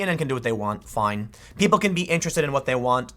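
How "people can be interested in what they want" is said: usual speaking voice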